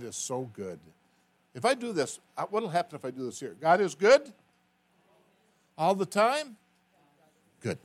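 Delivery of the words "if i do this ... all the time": high, loud call for a response; call-out to the crowd. Real, not acted. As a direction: shout it to the room. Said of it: "call to congregation".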